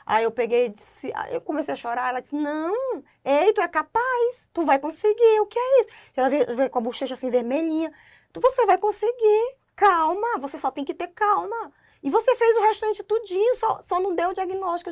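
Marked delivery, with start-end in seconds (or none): 2.3-5.9 higher voiced caring mentor; quoting a mentor's pep talk, higher; imitating doctor consoling. Mentor consoling crying student. 8.3-14.9 higher voiced caring mentor; quoting a mentor's pep talk, higher; imitating doctor consoling. Mentor consoling crying student.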